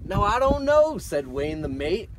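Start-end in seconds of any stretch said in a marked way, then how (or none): 0.0-1.0 put-on old sailor voice. Voicing Wayne the mate.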